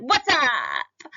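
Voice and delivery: sudden loud, casual street greeting; casual slang. Shown as a demonstration. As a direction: drop the teacher voice and go slangy. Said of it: "what if I suddenly went".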